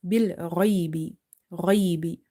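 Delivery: throaty full-mouthed ghaibi; heavy letter. Shown as a demonstration. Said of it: "Try making it as heavy as you can".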